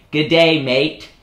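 broad Aussie Good day, mate; Australian accent. Shown as a demonstration. Son demos the Aussie accent to his mom, broad and cheery. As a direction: lay on a cheery Aussie twang. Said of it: "it's all in the E's".

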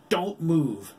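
high, loud furious order; raging. A narrator's reading. Jake holds the doctor at gunpoint, raging. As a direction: bark it in rage through the teeth. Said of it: "he raged through his teeth".

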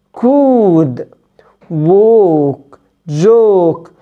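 ستون said slowly with a long rounded "o"; long English o. Shown as a demonstration. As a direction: hold the long "o" in ستوف، ستون، بون، تشوز. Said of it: "نقولهم تاني". (أو طويلة ببطء)

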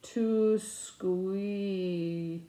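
slowly drawn-out, puzzled reading; slow sounding-out. Retold or imitated. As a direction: stretch each word as you work it out. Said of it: "and I say it slower".

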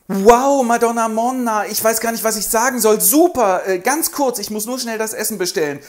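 high, loud, flustered admirer; flustered excitement. Acted. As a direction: babble like a starstruck suitor. Federigo flustered.

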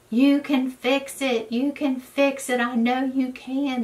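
high, slow begging voice; pleading friend. Retold or imitated. Friend begging her to fix the baby goat, high pleading.

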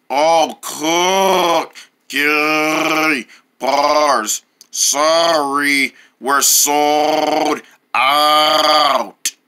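very slow, low, drawn-out clerk; slow shopkeeper voice. Acted. Clerk telling the boys they're sold out, very slow and low. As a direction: drag each word out like a sleepy old clerk. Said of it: "talking like that makes me wanna gag".

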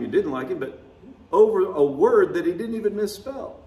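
usual speaking voice

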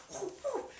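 high, light whoops; silly high hoots; imitated whoop. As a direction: mimic her goofy whooping. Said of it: "she pulled one of these".

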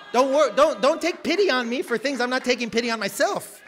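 loud, firm rebuke; a loud rebuke to pitying church folk; forceful rebuke. Acted. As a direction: push back hard at the pity. Preacher rebukes pitying people.

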